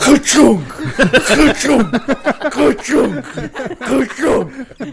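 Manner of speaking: loud laughing mechanical clunks; printing press voice. Pretend. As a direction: chant ka-chunk like a clanking machine. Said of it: "Adam, what happened".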